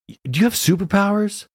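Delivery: high, lilting teacher question; talking to a kid. Retold or imitated. Teacher asking a small boy about superpowers, high kid-directed voice. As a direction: pitch it right up for a little kid.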